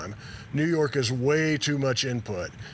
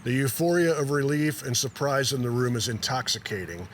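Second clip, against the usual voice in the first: ordinary delivery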